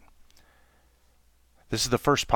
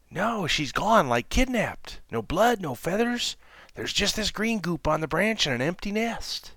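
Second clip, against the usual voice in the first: alarmed monkey; 10 semitones higher than usual. Acted. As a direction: panic at the empty nest. Louie alarmed.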